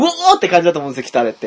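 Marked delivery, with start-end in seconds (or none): 0.1-0.4 high-pitched mock anime war cry; imitated battle roar "uoo". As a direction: demonstrate a Japanese-style fighting yell. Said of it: "日本的に言うところの". (日本のアニメ風「うおー」の雄叫びの真似)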